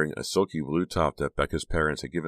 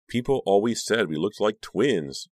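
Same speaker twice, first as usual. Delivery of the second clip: gushing; 6 semitones higher, 4 dB louder than usual. A narrator's reading. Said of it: "Honey gushed".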